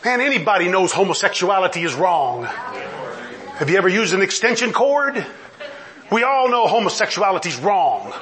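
ordinary talk, nothing marked

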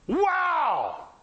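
high, long falling exclamation; amazed wow. Real, not acted. Preacher marvelling at miracles, amazed.

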